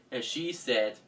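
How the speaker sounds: ordinary delivery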